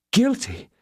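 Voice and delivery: high, outraged echo; indignant cry. Acted. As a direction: fling the word back at him. Indignant repetition.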